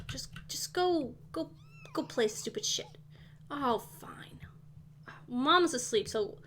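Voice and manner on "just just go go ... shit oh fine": whisper. Real, not acted. Whispering sims off while mom sleeps.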